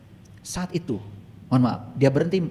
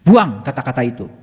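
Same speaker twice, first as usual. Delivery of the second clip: raised, insistent coaching voice; forceful exhortation; 5 semitones higher, 4 dB louder than usual. Retold or imitated. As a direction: urge them on, louder and higher as it builds. Exhorts participant to declare strength. (seruan tegas penuh semangat)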